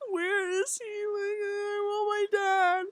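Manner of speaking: put-on high, slow plea of a little girl; high, slow childish plea; childlike pleading. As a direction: whimper it like a small child wanting her dad. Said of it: "I was like".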